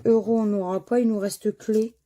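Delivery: ordinary delivery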